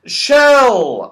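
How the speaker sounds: high, loud "Shell"; loud correction. A narrator's reading. Franny correcting the deaf Saucepan Man, loud. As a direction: call out "Shell" clearly. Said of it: "said Franny".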